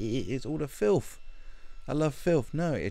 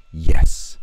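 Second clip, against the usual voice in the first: put-on a sudden loud "Yes" in a Jamaican accent; a quick Jamaican accent "Yes"; 15 dB louder than usual. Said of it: "trying to do her Jamaican accent".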